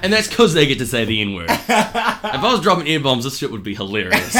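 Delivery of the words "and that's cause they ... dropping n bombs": high, loud laughing sarcasm; mock the edgy comics while laughing; real laughter, sneering; sarcastic laughing rant. Real, not acted.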